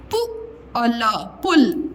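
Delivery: पु with short u, then ल, joined as पुल. Shown as a demonstration. Teacher builds the word for a child, short-u पुल. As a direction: spell out पुल slowly by parts, keeping u short. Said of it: "छोटा ओ की मात्रा का उच्चारण".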